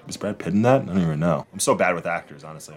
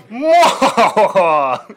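sudden high, loud cartoon cackle; cartoon villain laugh; 13 semitones higher, 10 dB louder than usual. Shown as a demonstration. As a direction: blurt a cartoon evil laugh. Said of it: "I was thinking more like, uh, Dexter's Laboratory, like, kinda laugh".

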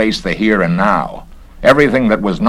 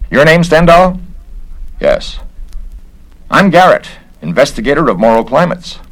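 higher and louder, brisk and self-important; the busybody official sizing him up; officious investigator; 8 semitones higher, 4 dB louder than usual. Acted. Garrett the investigator speaks.

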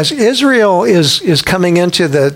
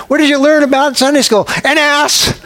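put-on high, fast parent question, kid's gleeful reply; parent asks, child answers; 8 semitones higher than usual. A parent quizzing a child in the back seat, high mock voices. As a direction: play the eager parent and cheeky kid.

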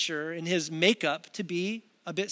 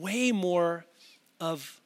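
ordinary talk, nothing marked

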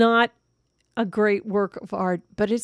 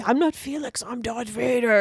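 child playing Vader; 3 semitones higher than usual. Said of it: "Felix's Darth Vader was very, very cute".

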